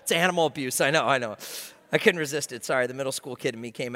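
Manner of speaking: laughing apology. Real, not acted.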